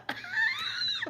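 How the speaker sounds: high-pitched laugh at own outburst; amused high laugh. Real, not acted. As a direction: laugh at yourself mid-story. Laughs while admitting losing temper.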